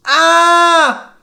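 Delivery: high, loud scream of fright. A narrator's reading. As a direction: shriek and run. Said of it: "Then he screamed".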